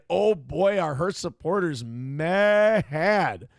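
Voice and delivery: gleeful emphasis. Real, not acted. Relishes how angry supporters are.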